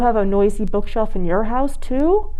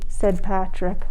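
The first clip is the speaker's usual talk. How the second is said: usual speaking voice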